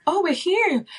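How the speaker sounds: light mock gasp of discovery; imitating her own moment of realizing; dawning realization. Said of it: "I was like".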